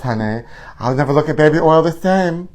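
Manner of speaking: ordinary talk, nothing marked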